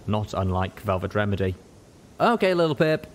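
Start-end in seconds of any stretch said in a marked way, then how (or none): 2.2-3.1 high, louder call to a friend; Calamity calling out. Acted. Calamity calls out to Little Pip, a cheerful call. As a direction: sing out the name across the cabin. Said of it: "Calamity called out".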